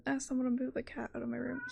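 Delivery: urgent whispered aside. Real, not acted. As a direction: whisper it off mic. Aside to family off mic.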